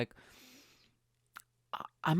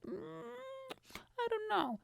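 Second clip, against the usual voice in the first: put-on high coy girl's voice; 9 dB quieter, faster than usual. Girl playing hard to get, high coy voice. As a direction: play it coy and hesitant.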